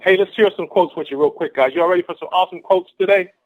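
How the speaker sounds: rally the crowd with rising energy; pumped-up rallying. Real, not acted. Speaker readies the team for quotes, pumped-up rallying.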